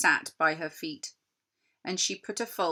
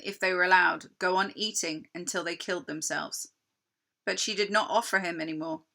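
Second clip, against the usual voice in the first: ordinary delivery, nothing marked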